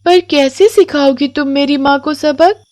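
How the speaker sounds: put-on high child voice, gentle and wondering; curious little girl. Komal gently asks the crying witch, high child voice.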